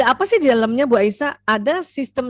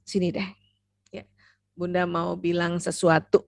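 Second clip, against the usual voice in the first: gentle invitation; 4 semitones lower than usual. Shown as a demonstration. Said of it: "Ajak anak-anak".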